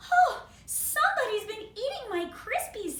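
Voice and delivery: high, loud shocked mum voice; indignant mother. Acted. Mother bear finding her Crispies gone, high and loud. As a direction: squeal it like an outraged mother.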